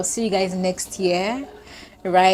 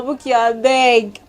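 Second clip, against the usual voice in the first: slow, higher, self-pleading; pleading with herself; 4 semitones higher, 6 dB louder, slower than usual. Real, not acted. Vlogger pleading with herself not to cry. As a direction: beg yourself to hold it together. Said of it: "I'm trying not to get emotional".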